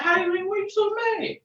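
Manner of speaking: high, slowed, half-crying call; a strained, high plea to an angry kid; mock pleading shout. Acting out calming an angry kid.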